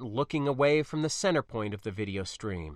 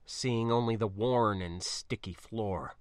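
plain reading voice

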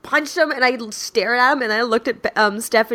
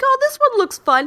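much higher, innocent mock voice; smug innocent. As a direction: play dumb, sweetly. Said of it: "He was like".

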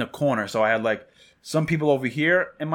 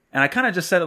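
plain reading voice

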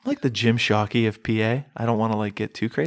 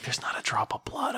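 hushed, stunned whisper; whispering to himself over the empty ground; panicked whisper; 2 semitones higher, 11 dB quieter than usual. Retold or imitated. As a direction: whisper it in disbelief.

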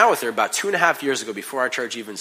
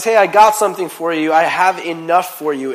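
booming voice of God; 6 semitones higher, 12 dB louder than usual. Retold or imitated. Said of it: "God says".

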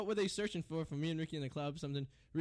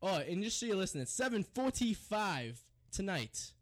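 ordinary talk, nothing marked

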